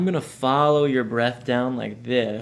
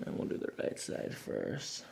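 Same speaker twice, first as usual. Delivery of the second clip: soft spa whisper; 3 semitones higher, 11 dB quieter, slower than usual. Acted. Calming whisper during adjustment.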